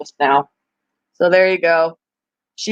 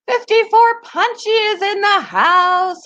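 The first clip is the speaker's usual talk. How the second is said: excited announcement; 12 semitones higher than usual. Real, not acted. Greeting a pet in the cage.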